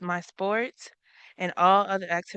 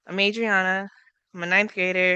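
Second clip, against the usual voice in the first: normal talking voice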